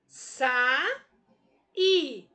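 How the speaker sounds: "sa, i" as two loud, isolated syllables; pronounced separately. Shown as a demonstration. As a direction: punch "sa", pause, then punch "i". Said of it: "Pronunciei separado".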